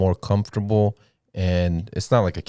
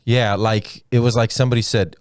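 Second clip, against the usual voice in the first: usual speaking voice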